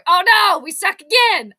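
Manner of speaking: high, loud movie-quote wail; loud high despairing quote, hammed up; Waterboy movie quote. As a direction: belt out the famous Waterboy line. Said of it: "To quote the nineteen ninety-eight movie, The Waterboy".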